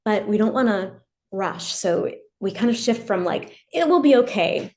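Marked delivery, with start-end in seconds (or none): 3.7-4.7 higher, louder quick comforting line; brisk reassurance. Retold or imitated. Parent quickly soothing a venting teen, higher and louder. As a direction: brush it off kindly. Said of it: "which sounds like it might be comforting".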